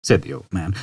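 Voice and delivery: usual speaking voice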